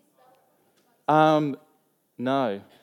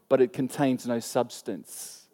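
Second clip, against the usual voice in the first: quiet emphasis; 7 semitones lower, 7 dB quieter, slower than usual. Acted. Sermon point softly repeated.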